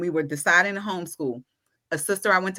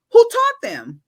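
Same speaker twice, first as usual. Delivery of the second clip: "Who taught them?" pitched up high and louder; rhetorical challenge; 7 dB louder, slower than usual. Real, not acted. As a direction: throw the question at the doubters.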